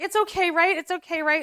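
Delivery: anxious pleading. Retold or imitated. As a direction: beg for reassurance. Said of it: "So I'm like".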